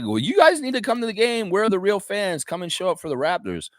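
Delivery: high-pitched mock rant at absent fans; Mike Roach, really mad. Broadcaster urging fans to come to the game, mock mad. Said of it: "Mike's really mad. He's like".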